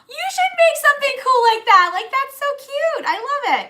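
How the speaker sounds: high, loud, gushing squeal of delight; gushing excitement. Retold or imitated. Wife gushing to husband about a cute shirt, squealing high and loud. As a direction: squeal it like you just saw the cutest shirt.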